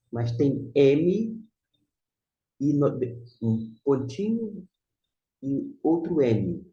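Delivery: teacher emphasizing the doubled M; slow emphasis. Shown as a demonstration. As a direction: stress each letter deliberately. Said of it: "pointing out the doubled M".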